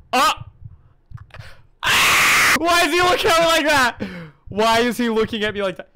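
very high, loud, frightened cry; panicked scream. Real, not acted. Streamer freaked out by a staring man, screaming. Horror jump scare.

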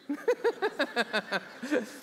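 a high burst of laughter; open, high laugh at the punchline. Real, not acted. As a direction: let out a real, high laugh. Laughs at the absurd outcome.

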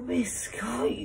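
ordinary delivery

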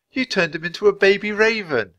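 put-on high-pitched little girl; high, earnest girl; girl's voice. A narrator's reading. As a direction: marvel at it in a high voice. Annie's voice in dialogue.

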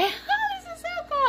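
tiny high squeal; hushed, very high-pitched gush; giddy delight. Real, not acted. Marvelling at new gimbal.

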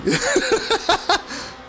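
a high nervous giggle; nervous laugh. Real, not acted. Streamer laughs at his own nerves, high giggle. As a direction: let out a high jittery laugh.

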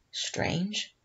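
a soft whisper, almost to himself; captain whispering over the empty town. A narrator's reading. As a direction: breathe the word in wonder. Captain muses over missing settlers.

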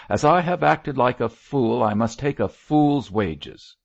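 loud, high, rough outburst. A narrator's reading. Chaplain owning his folly to the bishop, roughly. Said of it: "he said roughly".

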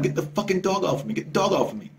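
a mock curse at the dog owners; cursing at the owners. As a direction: snap at the owners to get their dog. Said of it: "start cursing at them".

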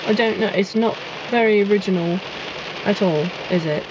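put-on British accent; mock-British musing with a tag question. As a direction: play it as a British person. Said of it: "Oh, my accent".

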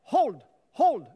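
loud, drawn-out hold across the room; loud stretched hold; called out hold. Real, not acted. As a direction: make them freeze in place. Coach calling out during dance.

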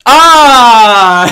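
loud, high, long-drawn "aah"; gloating "ah". Real, not acted. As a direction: bask loudly in the compliment. Mock gloating at caller's compliment.

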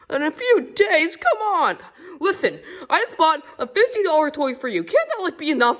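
high, loud, exasperated complaint; indignant whining; whiny outrage. Acted. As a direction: protest like a spoiled kid. Kid complains delivery is slow.

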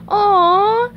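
high, drawn-out doting aww; SpongeBob cooing. Acted. Seeing his snail offer his belly, high coo. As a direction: coo loudly over a pet.

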